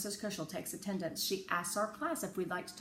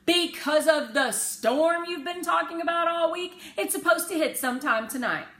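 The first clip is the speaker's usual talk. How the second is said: father explaining; 11 semitones higher, 10 dB louder than usual. A narrator's reading.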